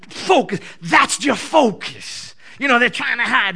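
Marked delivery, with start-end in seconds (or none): 0.1-2.3 commanding call. Retold or imitated. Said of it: "the Spirit of the Lord just takes my eyeballs and goes".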